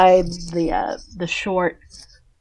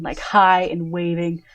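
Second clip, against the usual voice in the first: usual speaking voice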